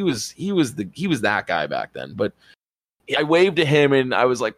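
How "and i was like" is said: usual speaking voice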